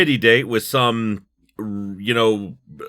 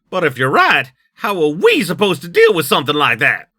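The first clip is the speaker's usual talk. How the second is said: higher, louder character voice in a put-on accent; Nix's put-on accent; 6 semitones higher, 6 dB louder than usual. Challenging a colleague about the suspect, in a put-on accent. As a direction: push the put-on accent up high and loud. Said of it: "that is his accent now".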